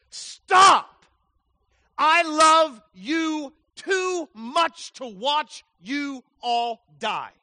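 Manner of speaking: very high, loud, slow scream of Stop then pleading; God screaming. Acted. God screaming at dying humanity, loud and slow. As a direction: scream it like God storming in to save them. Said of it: "he screams it".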